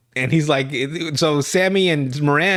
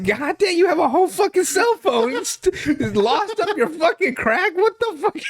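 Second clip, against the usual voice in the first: much higher, stunned and laughing disbelief; incredulous outburst; 13 semitones higher than usual. Real, not acted. Reacting to a whole phone being lost, in high shocked disbelief. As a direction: squeal it out in shock. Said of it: "I'm like".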